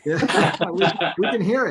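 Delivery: laughing while speaking. Real, not acted. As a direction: chuckle through the words. Host laughs at accent joke.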